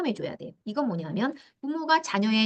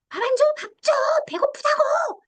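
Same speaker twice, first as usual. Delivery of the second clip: much higher, mock shrieking child; child screaming. Hungry child yelling at mom for food, mock screech. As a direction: wail for dinner like a hungry kid. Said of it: "이렇게 악을 쓰고 있을 때".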